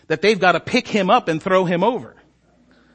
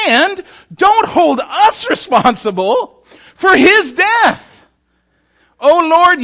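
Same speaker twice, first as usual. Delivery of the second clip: much higher, louder, slow with a laugh; hammed-up begging not to be blamed; desperate sailor prayer; 10 semitones higher, 7 dB louder, slower than usual. Sailors pray to be spared.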